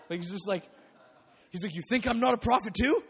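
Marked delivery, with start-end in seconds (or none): 1.7-3.0 loud indignant comeback, pitch up; indignant loud retort. Retold or imitated. Elisha snapping back at the other prophets, loud and indignant. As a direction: shout back that you're a prophet too. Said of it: "that's what I hear in that".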